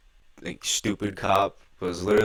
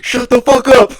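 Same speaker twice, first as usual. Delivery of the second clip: loud high yell to shut someone up; retold shout; 15 dB louder than usual. Friend in the car yelling at him loudly. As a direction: bark it out at full volume. Retelling a heated outburst.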